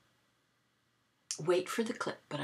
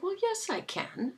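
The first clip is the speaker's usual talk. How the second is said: playful comeback; 12 semitones higher than usual. Retold or imitated.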